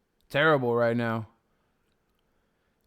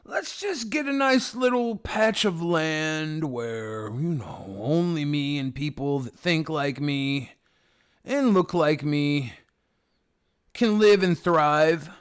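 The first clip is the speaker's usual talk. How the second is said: mock someone pleading for their own little patch; mocking ethno-state advocate; 3 semitones higher than usual. Streamer parodies someone who can't get along with everyone, mocking. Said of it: "You're like".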